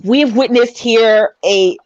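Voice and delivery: live play-by-play, rising with the action; wrestling commentary. Acted. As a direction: call the fight like a ringside commentator. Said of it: "you have to commentate".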